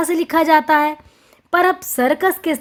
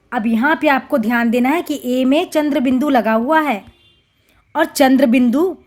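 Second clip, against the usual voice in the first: ordinary delivery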